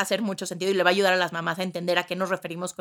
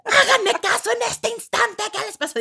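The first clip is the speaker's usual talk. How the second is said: yelling pilot; 13 semitones higher, 5 dB louder than usual. Pretend. Pilot losing control, shouting at passengers, hammed up. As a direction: scold passengers like an unhinged captain. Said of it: "piloto hablando ... gritando".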